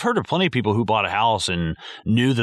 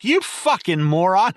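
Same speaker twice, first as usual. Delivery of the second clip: scornful insult; 9 semitones higher, 3 dB louder than usual. Pretend. Telling off the exterminator.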